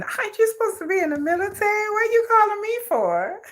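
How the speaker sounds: put-on high, quick teasing girl voice; high mock girl. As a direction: tease him in a high girly voice. Woman reacts.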